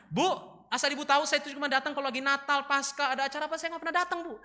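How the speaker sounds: high, insistent confession; pleading pupil. Acted. Brian explains himself to his teacher, high-pitched pleading.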